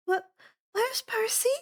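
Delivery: put-on high, anxious girl's voice asking fast; a worried young girl demanding an answer. Annabeth to Dionysus, a high worried girl's voice.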